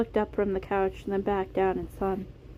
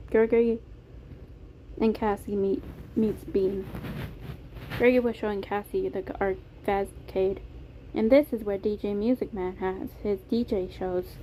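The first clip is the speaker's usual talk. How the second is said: usual speaking voice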